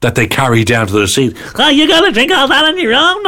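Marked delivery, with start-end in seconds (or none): 1.6-3.2 high, hammed-up American accent. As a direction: gush like a big-hearted American server. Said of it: "Whose accent?".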